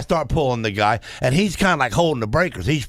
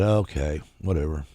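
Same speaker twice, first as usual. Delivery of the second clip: mild dismissive sigh, much softer; softly giving up on the argument; 7 semitones lower, 5 dB quieter than usual. Retold or imitated. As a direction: let it go, quietly. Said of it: "I said".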